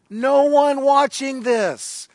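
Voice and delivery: "No one watching this" slow and high; emphatic restatement. Real, not acted. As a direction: stretch out "No one watching this", pitched well up. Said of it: "Let me emphasize that".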